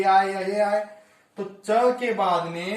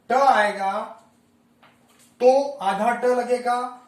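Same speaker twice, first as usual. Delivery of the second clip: ट hit hard and loud, then slower; stressed letter; 3 semitones higher, 5 dB louder, slower than usual. Shown as a demonstration. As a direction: punch ट loudly like a teacher driving it home. Teacher stressing the key letter. (ट पर ज़ोर देकर बोलें)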